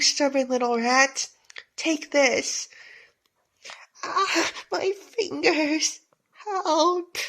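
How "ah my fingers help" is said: crying out in pain. Acted. Fingertip bitten off.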